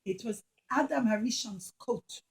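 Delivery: plain reading voice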